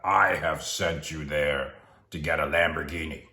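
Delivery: lightly voiced mock voice of God, measured; a hint of grand divine command; booming Hollywood God. God sending Daryl to the car lot, mock-solemn. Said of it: "the tone of my voice, like".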